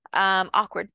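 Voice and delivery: normal talking voice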